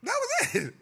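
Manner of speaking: high excited repeat. Real, not acted. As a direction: crow it like a punchline win.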